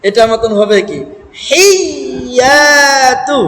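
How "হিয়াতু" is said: হিয়াতু very slow with a push on হি; slow pushed syllable. Shown as a demonstration. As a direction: stretch হিয়াতু and thrust the হি. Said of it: "ধাক্কার মতো হবে".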